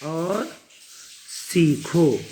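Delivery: very slow, syllables stretched for learners; deliberate classroom reading; slow model reading. Shown as a demonstration. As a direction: read it out word by word, drawn out. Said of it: "यहाँ पर देखो".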